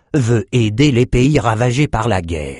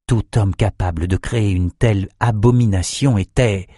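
usual speaking voice

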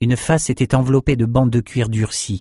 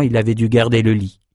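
usual speaking voice

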